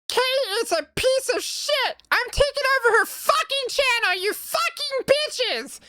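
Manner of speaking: cackle-ready raccoon villain, gloating; scratchy Bakun voice. Acted. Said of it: "can you talk in a scratchy voice".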